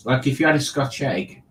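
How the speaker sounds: ordinary talk, nothing marked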